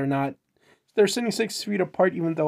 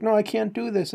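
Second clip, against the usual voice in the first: higher-pitched mock protest; 6 semitones higher than usual. Man refusing an offer, mock protesting. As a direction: balk in a raised voice. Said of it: "And he's like".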